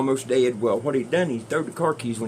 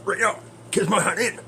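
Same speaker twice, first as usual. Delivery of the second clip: hammed-up censored movie cussing, pitched way up; TV-edit dub; 10 semitones higher, 4 dB louder than usual. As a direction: put on a high, silly overdubbed TV voice. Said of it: "I love the T B S version".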